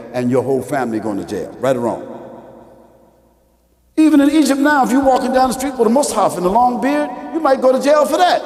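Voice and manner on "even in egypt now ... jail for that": raised-voice preaching. Real, not acted. Preacher warning the congregation about jail in Egypt, raised-voice preaching. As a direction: preach it out with force.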